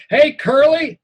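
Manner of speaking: high, loud yell in a rough voice; a bully yelling a nickname at the boy. Acted. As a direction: shout it across the playground. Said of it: "he keeps yelling".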